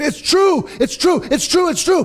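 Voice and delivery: high, urgent repeated cry; acted-out excited shouting; breathless excitement. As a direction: burst in with astonishing news. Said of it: "they're out of breath".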